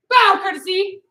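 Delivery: loud drill call; command call. Real, not acted. Instructor directing students to bow, loud call.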